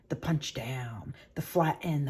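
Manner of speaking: slow hushed whisper, savoring the moment; a slow, teasing whisper before the punch; dramatic whisper. Acted. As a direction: whisper it like a secret treat. Best part, punching the dough.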